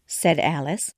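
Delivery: ordinary delivery, nothing marked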